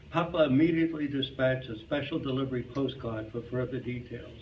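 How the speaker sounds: ordinary delivery, nothing marked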